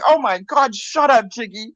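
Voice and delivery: mock exasperation. Telling her teasing friend off, mock exasperated.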